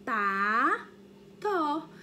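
"Pato" said slowly, opening the mouth wide on pa and to; teacher modelling "Pato" in two syllables. Shown as a demonstration. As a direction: mouth each syllable of "Pato" for kids to copy. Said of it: "Vamos abrir a boquinha junto com a tia".

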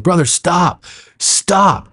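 high-pitched mock urgent command; re-enacted urgent plea. Pastor to the man attacking him, high re-enacted plea. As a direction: act out fending off an attacker, voice high.

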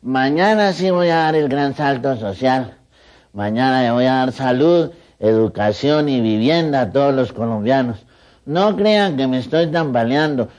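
loud, slow, tipsy campaign promise; tipsy politician speech. Retold or imitated. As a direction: slur a drunk president's promises. El doctor Samper borracho. (discurso lento y fuerte de político borracho)

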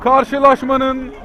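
loud, high goal yell; screaming football commentator. Real, not acted. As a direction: shout the late winner. Late goal, commentator erupts.

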